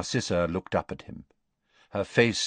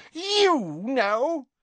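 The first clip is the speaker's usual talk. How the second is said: outraged Bellatrix. Acted. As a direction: spit it out in outrage. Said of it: "look of outrage".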